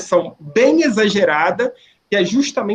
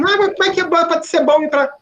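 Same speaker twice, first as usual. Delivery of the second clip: much higher, gushing mock reply; naive mock enthusiasm; 9 semitones higher than usual. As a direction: play the reader who misses the irony. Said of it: "você fala assim". (empolgação ingênua e exagerada, bem mais aguda)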